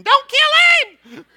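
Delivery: very high, loud, slow mock panic; frantic mom. Mom running in to stop the whipping, high frantic shriek. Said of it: "My mom runs in".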